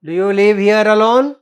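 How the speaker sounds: a gleeful emphatic demand. Acted. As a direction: press the question hard, with relish. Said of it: "with an emphasis, with joy".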